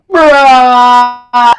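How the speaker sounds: one word held out loudly, level pitch; long loud bruh. Retold or imitated. Kid replying to the pizza guy, long loud bruh. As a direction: drag it out as a loud mock comeback. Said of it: "So he was like".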